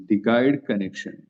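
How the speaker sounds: the guide connection said very slowly; slow teacher dictation. Shown as a demonstration. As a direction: spell out the name for the class. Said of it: "जिसका नाम है".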